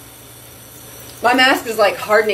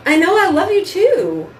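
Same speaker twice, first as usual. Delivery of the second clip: much higher doting voice to the dog; high pet talk; 8 semitones higher than usual. Real, not acted. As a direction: answer the dog sweetly up high.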